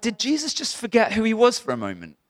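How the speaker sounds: high-pitched incredulous question; mock disbelief, pitched right up; retold incredulity. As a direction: act out being baffled by it. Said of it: "I'm like".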